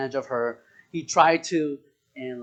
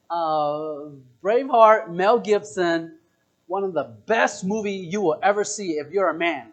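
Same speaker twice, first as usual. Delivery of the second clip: usual speaking voice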